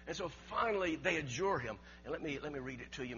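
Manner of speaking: normal talking voice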